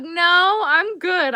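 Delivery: chirpy reassurance. Real, not acted. Reassuring chat she's not sad.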